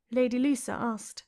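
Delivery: ordinary delivery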